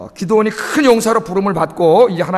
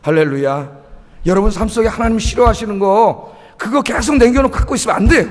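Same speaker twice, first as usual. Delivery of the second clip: ordinary delivery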